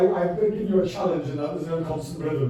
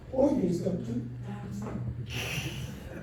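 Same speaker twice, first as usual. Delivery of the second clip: slow, hushed mock of a stern producer; 4 semitones higher, 9 dB quieter, much slower than usual. To the audience, lightly mocking the serious producer, slow and hushed. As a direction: drag it out like a solemn TV boss. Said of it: "by the serious producer".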